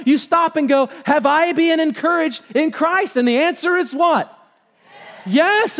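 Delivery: high, loud call-and-response preaching; full-force preaching. Real, not acted. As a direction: drive the congregation to answer emphatically.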